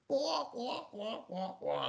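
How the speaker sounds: imitated villain cackle, starting high; mock the laugh in soft, repeated wahs; hammed-up "wah wah" villain laugh; Joker laugh. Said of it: "And then you get Joker".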